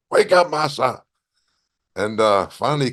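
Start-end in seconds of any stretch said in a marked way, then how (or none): 0.1-1.0 referee calling out. Retold or imitated. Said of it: "and Tori even says, he's counting him".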